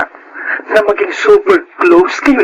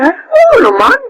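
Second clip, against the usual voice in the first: emphatic agreement; slower than usual. Acted. Aunt affirms her loneliness.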